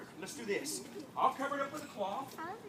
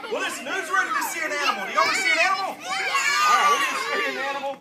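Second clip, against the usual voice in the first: excited; 10 semitones higher, 11 dB louder than usual. Acted. Hyping animal reveal.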